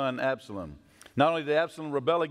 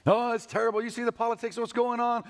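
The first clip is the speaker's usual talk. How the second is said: higher, hammed-up worried complaining; mock fretting; 8 semitones higher than usual. Shown as a demonstration. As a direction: fret about the news in a higher voice. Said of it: "We tack that on".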